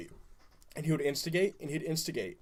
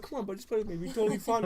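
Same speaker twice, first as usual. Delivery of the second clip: higher, softer wheedling; mock coaxing; 6 semitones higher, 4 dB quieter than usual. Retelling coaxing little brother to play, soft wheedling. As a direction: sweet-talk him in a lighter, higher voice.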